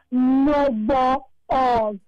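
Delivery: put-on very slow, drawn-out South African statesman accent; Nelson Mandela. Guest answering whether she'd date an inmate again, slow Mandela impression. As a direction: imitate the late statesman's accent, stretching every syllable. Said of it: "in the, the, the tone and accent of the late Tata Rolihlahla Mandela".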